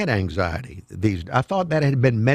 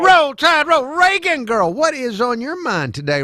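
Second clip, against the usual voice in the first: excited greeting; 11 semitones higher, 4 dB louder than usual. Real, not acted. Host greets caller excitedly.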